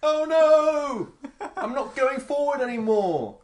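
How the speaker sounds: high squeaky panicked voice; panicking swimmer. Retold or imitated. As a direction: squeal like a swimmer losing their nerve.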